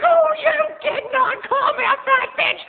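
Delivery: very high, loud, slow; outraged diva. Retold or imitated. Mom insulted by clerk, very high loud outrage. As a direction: shriek in disbelief. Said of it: "And she's like".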